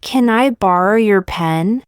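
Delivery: ordinary delivery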